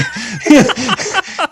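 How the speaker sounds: high burst of real laughter. Real, not acted. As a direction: laugh out loud at the memory. Laughing at the punchline.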